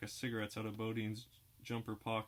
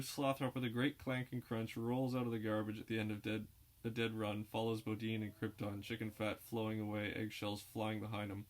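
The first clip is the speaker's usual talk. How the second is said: usual speaking voice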